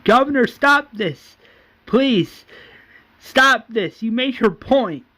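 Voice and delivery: high, loud desperate appeal; screaming plea. Acted. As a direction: plead over a fight for it to stop. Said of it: "we see Andrea screaming".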